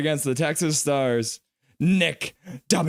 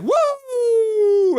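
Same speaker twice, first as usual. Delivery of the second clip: high, loud fan's whoop; 5 dB louder than usual. Retold or imitated. Voicing a chatter's cheer, a loud high whoop. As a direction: let out a big high woo. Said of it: "And Paragraph Pro is like".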